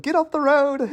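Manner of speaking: high, excited hobbit cry; mock frantic quote. Friend mimicking the game-night quote in a frantic high voice. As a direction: yelp it in a panic. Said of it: "you always quote".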